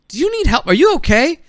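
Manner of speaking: loud, high urgent questions; insistent call right beside her. Retold or imitated. Bending down, shouting to the woman in the surf.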